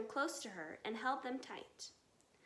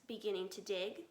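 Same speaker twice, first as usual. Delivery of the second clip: usual speaking voice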